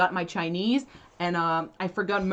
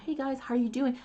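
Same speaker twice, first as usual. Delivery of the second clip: softer, higher echo of a video greeting; practicing by mimicry; 5 semitones higher, 5 dB quieter, faster than usual. Learner copying the video at the screen, quiet mimicked greeting. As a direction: repeat it back quietly like a learner. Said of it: "like, watching the screen".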